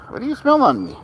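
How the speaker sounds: sudden high squeaky pet talk; high playful voice for the cat sniffing him. Real, not acted. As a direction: pitch way up to ask the cat.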